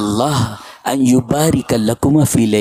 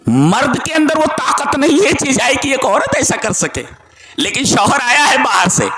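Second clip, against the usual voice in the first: much higher, near-shouted preaching; fervent exclamation; 10 semitones higher than usual. Real, not acted. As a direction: preach it out in wonder. Preacher exclaims over the woman.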